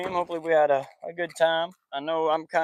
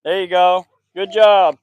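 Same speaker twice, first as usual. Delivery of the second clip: loud cheering praise; 2 semitones higher, 12 dB louder, slower than usual. Real, not acted. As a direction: cheer him on after the trick. Cheering kid's front flip.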